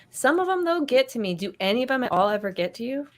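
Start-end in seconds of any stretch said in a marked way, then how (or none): none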